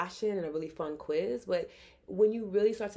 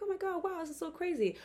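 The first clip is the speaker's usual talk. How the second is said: put-on excited little girl; 11 semitones higher than usual. Little girl thrilled by a compliment, imitated high excitement. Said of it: "she's excited".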